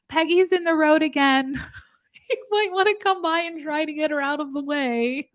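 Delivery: perky Facebook-group lady announcing a loose pig; chirpy neighbour post. Retold or imitated. Neighbourhood group poster, high chirpy mock announcement. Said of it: "the person in the Facebook group who's like".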